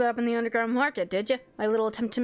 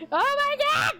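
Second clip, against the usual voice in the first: 1 overexcited; 7 semitones higher, faster than usual. Real, not acted. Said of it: "I'm fucking losing it".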